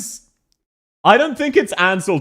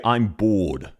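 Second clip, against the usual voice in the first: Australian accent; 6 semitones lower than usual. Shown as a demonstration. Streamer showing how Australians would say it, low Australian accent. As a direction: lower Australian "I'm bored". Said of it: "Australians would say".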